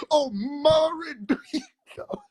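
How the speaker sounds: high, slow crying wail; mock talk-show crying. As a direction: ham up a tearful talk-show sob.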